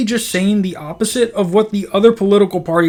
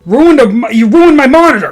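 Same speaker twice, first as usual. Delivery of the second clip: loud outraged shout, higher; angry yell; 7 semitones higher, 11 dB louder than usual. Retold or imitated. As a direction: yell it, furious and high. David Duke yelling about monitor.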